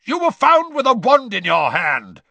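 high, loud accusing bark. A narrator's reading. Diggory accusing the elf, barking. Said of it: "barked Mister Diggory".

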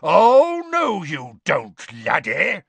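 high, loud, drawn-out roar. A narrator's reading. Moody stops the boy with a roar. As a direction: bellow it to stop someone. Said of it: "a roar which echoed".

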